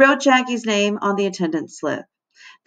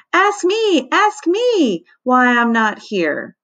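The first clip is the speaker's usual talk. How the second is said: put-on high, slow, pleading kid voice; eager begging; 8 semitones higher, much slower than usual. A narrator's reading. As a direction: beg for attention, stretching it out. Eager boy begging attention.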